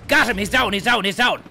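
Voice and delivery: triumphant yelled callout; alarm shout. Real, not acted. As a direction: shout it to the squad. Calling out a kill.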